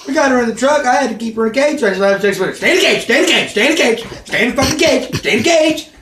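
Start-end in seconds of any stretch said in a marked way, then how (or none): 2.5-5.9 higher and louder, building to a fast frantic shout; escalating shouted orders. Retold or imitated. Telling the werewolf to stay caged, building to a frantic shout. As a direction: keep ordering her, more frantic each time.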